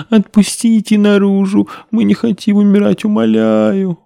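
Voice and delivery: high, tearful pleading; begging. A narrator's reading. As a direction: beg for your life through tears. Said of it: "снова взмолился". (мольба сквозь слёзы)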